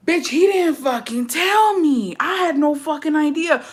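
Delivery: high, drawn-out protest; indignant retort. Retold or imitated. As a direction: replay an exasperated comeback, pitched high and stretched. Acted protest to mother's accusation.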